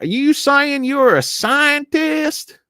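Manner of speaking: high, loud, drawn-out caricature; slow, high mock interrogation of a witness; mock indignant congressman. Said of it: "this conservative, uh, congressman questioning John Kerry".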